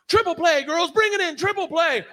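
high, excited imitation of the coach; hammed-up cheering coach; mock coach cheer. Coach cheering the girls on, high and excited. Coach celebrating imaginary play.

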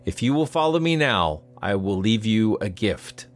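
measured storyteller's read over music; story narration. A narrator's reading.

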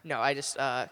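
usual speaking voice

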